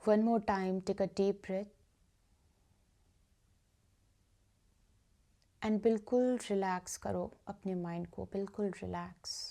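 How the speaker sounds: guided meditation. Acted. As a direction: guide listeners gently into stillness, softening on repeated phrases. (धीमी, शांत ध्यान कराने वाली आवाज़)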